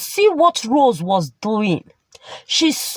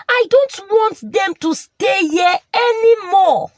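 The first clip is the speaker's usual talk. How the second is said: high tearful shout; crying outburst; 7 semitones higher than usual. Acted. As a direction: let it burst out in a sob-shout. Wife breaks down over the children.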